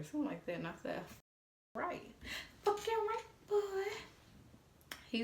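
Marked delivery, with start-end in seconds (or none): 1.7-3.8 mock squeal. Mocking his text back.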